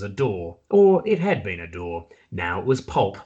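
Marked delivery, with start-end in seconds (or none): none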